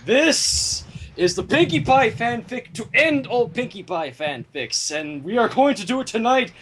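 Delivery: ordinary talk, nothing marked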